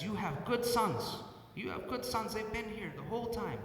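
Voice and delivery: imitating a foreign cleaning lady's broken English, slow and high. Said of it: "she's kind of got some broken English".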